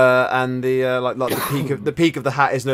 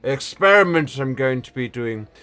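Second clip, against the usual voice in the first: put-on old man voice; 3 semitones higher than usual. GM continues old wizard NPC.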